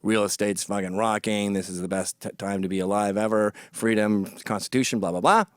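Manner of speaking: low monotone spew of talking points; mock political venting. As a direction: drone out a list of slogans, pitched well down. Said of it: "we can just blah, barf".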